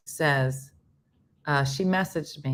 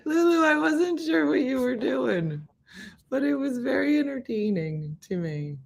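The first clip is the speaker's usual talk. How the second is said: high, sing-song voice to a pet; pet talk; 9 semitones higher than usual. Real, not acted. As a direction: coo at the dog. Talking to her pet Lulu.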